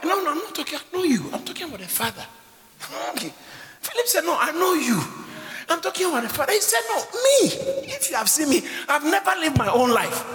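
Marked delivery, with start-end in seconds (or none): 3.8-10.2 high, loud, fervent preacher shout; full-force preaching. Acted. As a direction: thunder it out to the congregation.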